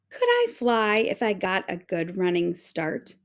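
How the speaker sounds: ordinary delivery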